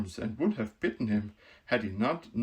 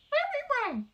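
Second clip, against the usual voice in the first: mouse queen voice; 3 dB louder than usual. Acted. Queen telling the Woodman who she is, proud squeak. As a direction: announce her rank grandly in a squeak.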